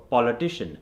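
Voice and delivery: politician with a forward "o" in an Indian accent. Shown as a demonstration. Coach demonstrates the accent to fix, Indian accent. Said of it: "An Indian accent is gonna go".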